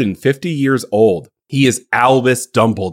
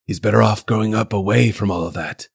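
put-on soft wise old wizard; 3 semitones lower, 5 dB quieter than usual.

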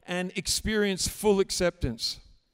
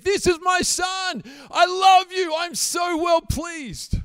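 much higher, loud joyful proclamation by the Father; excited shout; 10 semitones higher, 8 dB louder than usual. Retold or imitated. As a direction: shout it out like a proud dad. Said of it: "so excited to shout praise".

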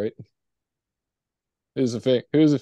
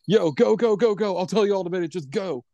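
urgent panicky; 5 semitones higher than usual. Retold or imitated. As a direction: hurry them off in a panic. Retold panicked friend urging flight.

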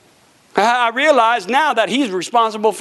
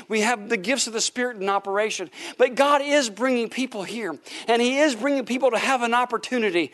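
ordinary delivery

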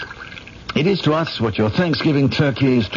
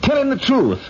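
high-pitched, heated insistence; angry demanding tone; 9 semitones higher than usual. Acted. Husband demanding his wife tell her boss, heated high tone. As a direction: order her about, pitch climbing sharply. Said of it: "talking to me in that tone of voice".

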